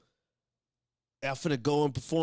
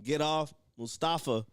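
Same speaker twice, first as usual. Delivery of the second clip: ordinary talk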